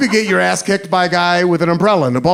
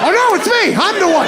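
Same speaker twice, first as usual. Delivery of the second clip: very high-pitched dawning panic; hammed-up dismay; mock alarm; 3 dB louder than usual. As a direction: squeak out the awful realization. Realizes he is the one threatened.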